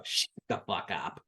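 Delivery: disgusted mutter. Real, not acted. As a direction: mutter it to the screen. Reacting to groom's line.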